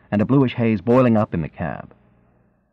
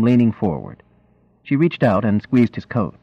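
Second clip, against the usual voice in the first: usual speaking voice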